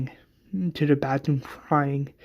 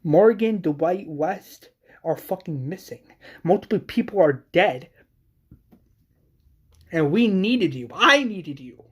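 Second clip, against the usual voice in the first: higher, forceful angry outburst; raised, anguished reproach; acted screaming; 4 semitones higher, 3 dB louder than usual. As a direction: hurl the accusation, hitting key words hard. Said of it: "she's screaming, saying".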